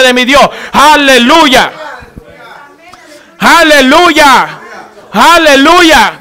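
loud declarations with long, drawn-out hallelujahs; full-force preaching. Real, not acted. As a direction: proclaim it to the whole congregation. (predicando con fuerza)

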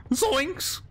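high yelping Scooby-Doo cry; Shaggy impression. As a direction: yelp it like Shaggy spotting a ghost. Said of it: "watching some Scooby-Doo".

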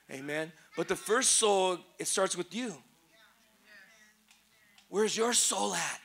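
prayerful preaching. Acted. Softer scripture and prayer.

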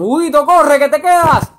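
high, loud yell from the truck; yelled warning. Retold or imitated. Friends on the departing truck yelling at him, loud and high. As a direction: shout to him to hurry up and run. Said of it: "Me gritaron".

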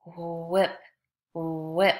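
"whip" with a held oo-like "wh" gliding into ip; stretched wh sound. Shown as a demonstration. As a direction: draw out the start of "whip". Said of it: "Listen as I say this word".

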